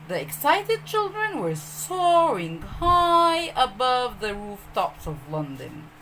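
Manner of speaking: plain reading voice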